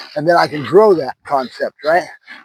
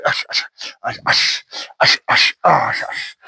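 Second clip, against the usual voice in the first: hushing sounds; 2 semitones lower than usual. Real, not acted. Calming the puppy.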